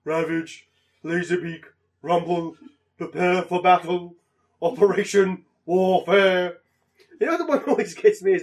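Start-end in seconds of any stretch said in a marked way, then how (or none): 0.0-6.5 slow, louder commanding robot voice; Soundwave voice. Retold or imitated. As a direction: bark orders like a Decepticon commander. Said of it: "just taking my phone and just going".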